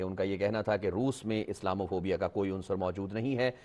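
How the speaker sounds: ordinary talk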